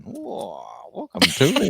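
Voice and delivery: hammed-up fifties comedian welcome. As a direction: greet like a fifties stage comic. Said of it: "like, a fifties, um, comedian".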